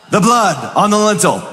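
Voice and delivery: usual speaking voice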